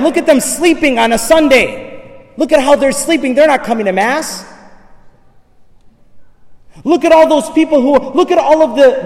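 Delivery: loud, high, mocking tempter rising to a shout; taunting devil. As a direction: sneer at Jesus as the devil, pushing and pushing. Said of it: "He was saying".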